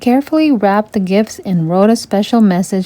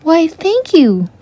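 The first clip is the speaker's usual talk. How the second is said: high, slow and gracious; a flattered lady thanking; pleased housekeeper; 8 semitones higher, slower than usual. A narrator's reading. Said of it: "Carlotta replied".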